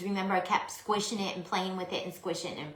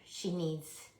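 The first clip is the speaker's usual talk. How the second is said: breathy whispered aside; soft conspiratorial whisper; 3 semitones lower, 8 dB quieter than usual. Real, not acted. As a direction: whisper it like a secret. Whispered aside while decorating.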